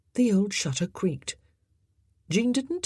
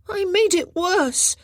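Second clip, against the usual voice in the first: remorseful; 14 semitones higher, 6 dB louder than usual. A narrator's reading. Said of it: "He sounded remorseful".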